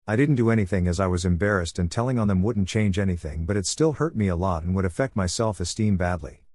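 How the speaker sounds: ordinary delivery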